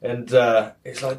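normal talking voice

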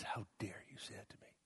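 retold outrage, hissed low; furious whisper. Angry wife confronting the pastor, whispered fury. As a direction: spit it out under your breath. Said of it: "she looked at me and she says".

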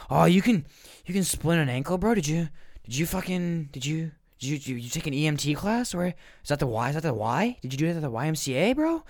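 high, eager bro voice, stumbling over questions; Martin. Acted. Nosy neighbor quizzes him about splinting an ankle, over-eager.

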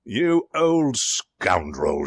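slow, drawn-out indignation; wrathful outburst. A narrator's reading. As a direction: denounce the butler with restrained volume. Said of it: "shouted Martin".

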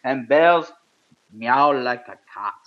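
put-on high, far-off Russian accent telling a tall tale; mock Russian accent, spinning a wild yarn; hammed-up Russian accent. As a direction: ham up a Russian storyteller's accent. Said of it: "I don't have a Russian accent".

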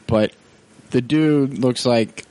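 ordinary delivery

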